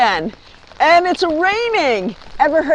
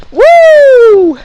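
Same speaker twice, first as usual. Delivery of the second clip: high loud whoop; loud happy woo; excited whoop; 11 semitones higher, 10 dB louder than usual. Real, not acted. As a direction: cheer the viewers off. Sign-off whoop.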